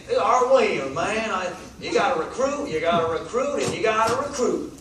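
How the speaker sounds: mock Southern coach hyping recruiting; southern accent. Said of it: "he talks that southern accent".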